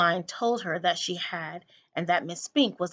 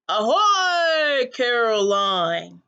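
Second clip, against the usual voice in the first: loud, slow drawn-out hail; calling out; 3 semitones higher, 6 dB louder, much slower than usual. A narrator's reading. Old man upstairs calling down to Coraline, loud. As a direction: hail her from upstairs, stretching the name. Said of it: "called the crazy old man".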